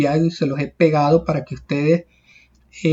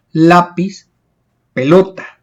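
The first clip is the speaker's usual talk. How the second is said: lápiz, pelota, punched on LÁ and LO; teacher's examples lápiz and pelota, penultimate syllables stressed; 4 dB louder, slower than usual. Shown as a demonstration. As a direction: hit the second-to-last syllable of lápiz and pelota. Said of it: "mayor intensidad de voz en la penúltima sílaba".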